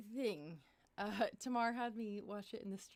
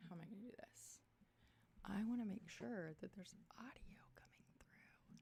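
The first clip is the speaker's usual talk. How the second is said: whisper; 8 dB quieter than usual. Real, not acted. Muttering while checking audio.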